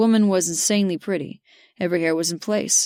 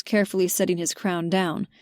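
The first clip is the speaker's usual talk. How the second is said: ordinary delivery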